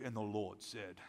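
low, solemn mock-holy voice; deep pompous prayer voice. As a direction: imitate a pompous preacher praying deep. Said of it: "the depth in their voice".

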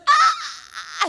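high, strained little scream with no voice left; a retold croak of a victory scream; hoarse mock scream. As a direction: imitate a scream that has nothing left. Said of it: "She jumped up and went".

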